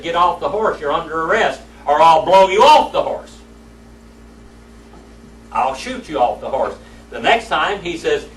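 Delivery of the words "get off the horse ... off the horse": retold holler. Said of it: "He hollered at him again".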